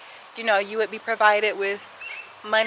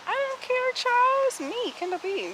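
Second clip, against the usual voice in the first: much higher, sassy put-on diva voice; 14 semitones higher, 4 dB quieter than usual. Vlogger to viewers about people staring, high sassy diva voice. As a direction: toss it off like a sassy diva, pitched way up. Sassy bit to camera, ignoring stares.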